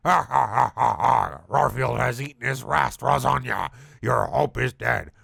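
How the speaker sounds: loud villain laugh, then gloating; evil guffaw. Acted. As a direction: cackle and gloat like a monster. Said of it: "Guffawed Odie with evil".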